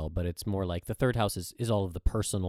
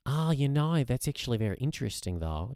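playful put-on Australian accent; hammed-up Australian, starting high; 5 semitones higher than usual. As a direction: muse aloud in a mock-Australian voice. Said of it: "the house of accents".

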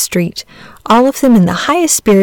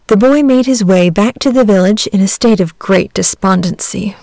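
usual speaking voice

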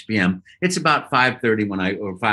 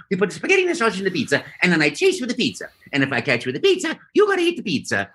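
very high, bouncy younger Italian character voice; Mario; 8 semitones higher than usual. Acted. Actor improvising his audition about food, high playful Italian voice. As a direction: play a happy cartoon plumber with a sing-song Italian accent, racing along. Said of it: "I'll do that voice, but I'll make him younger".

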